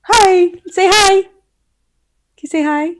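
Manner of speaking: talking to a pet. Real, not acted. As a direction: coo at the little dog.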